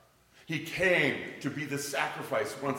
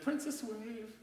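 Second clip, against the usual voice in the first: put-on old man calling out; 10 semitones higher, 8 dB quieter, slower than usual. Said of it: "that old man that people kept yelling at said".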